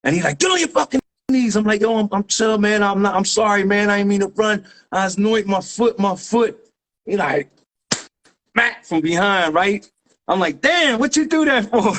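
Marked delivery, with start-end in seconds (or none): 0.4-1.6 high-pitched mock yell, no real volume; imitated cop command. Retold cop orders him down. 1.8-6.6 higher-pitched appeasing excuses; retold pleading. 10.6-11.9 very high-pitched mock complaint; indignant protest. Retold reaction to being smacked.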